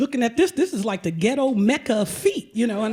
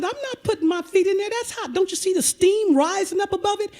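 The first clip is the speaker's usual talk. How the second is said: much higher, fast indignant protest; shocked refusal; 8 semitones higher than usual. Retold or imitated. Client refusing the hot tub, high indignant protest. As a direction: balk at the steaming water.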